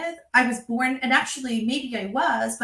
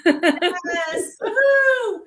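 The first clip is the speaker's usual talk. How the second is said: high, loud whoop of joy; excited cheer; 8 semitones higher, 6 dB louder, much slower than usual. Real, not acted. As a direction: cheer her on with a big woohoo. Cheering a supportive comment.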